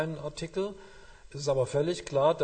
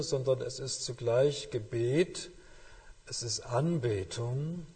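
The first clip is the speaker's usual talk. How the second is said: ordinary talk, nothing marked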